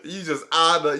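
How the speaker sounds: ordinary delivery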